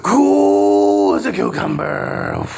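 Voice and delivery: low, slow, drawn-out mock calm; sarcastic low slow parody. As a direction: drop it low and stretch it out.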